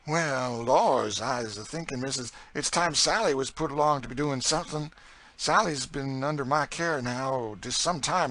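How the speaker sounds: persuasive Southern cook. A narrator's reading. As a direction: talk the mistress round in Southern dialect. Narrator voices Chloe persuading missus.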